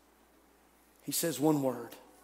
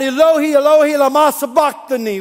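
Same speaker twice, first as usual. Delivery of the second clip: loud anguished cry, higher; cries out; 8 semitones higher, 10 dB louder than usual. Acted. Jesus crying out to God from the cross, loud and anguished. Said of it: "he cries out".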